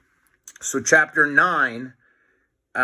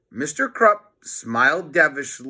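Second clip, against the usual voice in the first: plain reading voice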